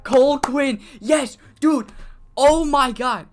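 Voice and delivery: loud, high-pitched fan outburst; high, forceful ranting yell; worked-up, mad excitement. Real, not acted. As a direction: shout it in fired-up exasperation and joy. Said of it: "I get so fucking mad".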